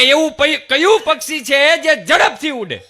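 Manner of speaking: again loud and high, a little cocky; mock loud student question repeated; repeated quiz question. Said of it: "ફરીથી બોલો".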